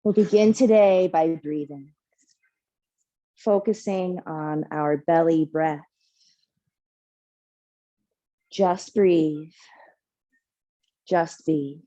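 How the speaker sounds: calm, measured meditation voice with long pauses; guided meditation. Real, not acted. Yoga teacher leading the class into rooting breath, meditative calm. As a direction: guide them gently into stillness, unhurried.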